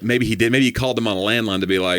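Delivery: normal talking voice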